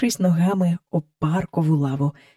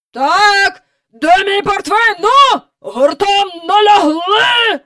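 loud, booming admiral's commands, pitched up; mighty sea-hardened voice; 12 semitones higher, 7 dB louder than usual. A narrator's reading. Admiral commands his crew from the air, bellowing. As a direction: bellow orders like an old sea captain. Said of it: "Залунав могутній, загартований у морі голос".

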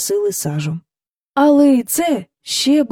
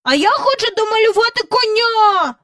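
put-on high whiny boy voice; boy sulks at his mother; sulky; 14 semitones higher, 4 dB louder than usual. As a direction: whine stubbornly. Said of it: "закомизився Майкл".

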